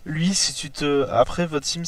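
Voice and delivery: ordinary delivery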